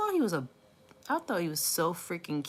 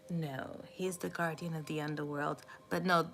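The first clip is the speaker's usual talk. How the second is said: ordinary talk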